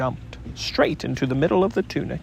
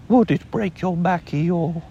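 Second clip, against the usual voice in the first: surprised Pooh; 3 semitones higher than usual. A narrator's reading. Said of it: "very much surprised".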